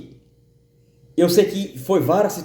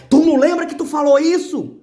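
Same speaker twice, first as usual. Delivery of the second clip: higher, louder accusing voice, pressing hard; stronger voice; 13 semitones higher, 6 dB louder than usual. Retold or imitated. The inner voice pressing the speaker about his words, much louder accusation. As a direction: make the accusation hit harder. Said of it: "A voz vinha mais forte".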